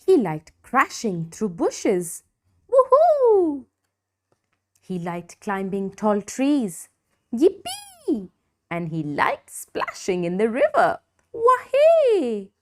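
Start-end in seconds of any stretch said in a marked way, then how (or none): 2.7-3.7 high joyful shout; gleeful whoop. A narrator's reading. Dinosaur whooping while crashing through bushes. 7.3-8.3 high squealing cheer; happy yippee. A narrator's reading. Dinosaur cheering as he climbs trees. 11.4-12.5 high, loud splashing cheer; joyful wahey. A narrator's reading. Dinosaur cheering while splashing in the river.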